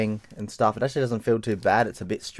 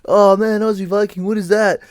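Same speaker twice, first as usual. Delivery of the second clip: high, loud mock drunken gushing; put-on drunk fanboy holler; drunk metalheads; 11 semitones higher, 6 dB louder than usual. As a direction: imitate pissed metalheads bellowing compliments at a stranger. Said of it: "drunk metalheads who are like".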